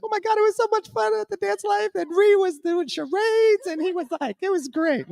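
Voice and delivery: very high, breathless fangirl voice; host mimicking a delighted guest, squeaky high gush; gushing attendee. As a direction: play a starstruck guest raving on the way home. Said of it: "they're like".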